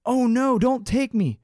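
high, loud goat plea; pleading goat. A narrator's reading. Second goat begging the troll, high pleading voice. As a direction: beg off as a storybook goat.

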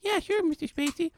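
mock little boy. Plays the boy answering.

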